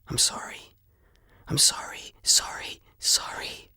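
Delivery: soft unvoiced whisper, repeating sorry; whispered apology. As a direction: breathe the apology without voice. Silent apology to ice maiden.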